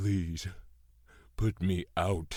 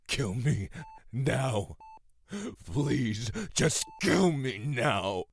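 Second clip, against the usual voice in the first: higher, louder, pleading to be killed; desperate loud begging; 7 semitones higher, 3 dB louder, slower than usual. Acted. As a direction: beg in despair. Father begs to die.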